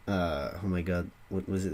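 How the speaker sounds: normal talking voice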